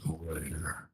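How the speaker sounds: low, soft one-word demand; low orc demand. Acted. Orc demanding the traitor's location, low growl. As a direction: growl the question quietly. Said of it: "and says".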